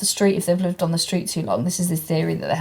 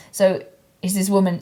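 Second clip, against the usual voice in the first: normal talking voice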